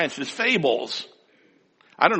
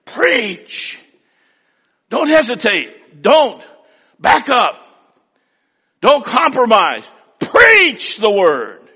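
higher, louder preacher commands; emphatic commands; 7 semitones higher, 5 dB louder, slower than usual. Shown as a demonstration. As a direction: drive each command home. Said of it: "Be emphatic about that word".

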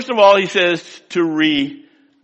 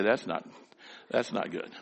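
mock quiet disapproval; 7 semitones lower, 14 dB quieter than usual. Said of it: "the guy I was quoting a minute ago would say".

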